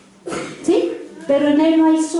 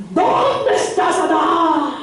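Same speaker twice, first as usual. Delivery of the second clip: slow, drawn-out booming summons; stern voice of God; much slower than usual. Acted. Preacher acting God calling Adam, drawn-out mock-stern call. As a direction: stretch the call out like thunder.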